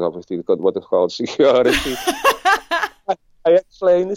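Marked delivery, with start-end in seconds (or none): 1.8-3.0 high bright laugh breaking out; burst of laughter. Real, not acted. Telling the host his story, a high laugh bursts out.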